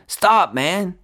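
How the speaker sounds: sudden high-pitched warning; mild yell. A narrator's reading. Lonnie stopping the boy under the house, mild yell. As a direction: cry out to halt him, without full volume. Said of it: "when Lonnie yelled".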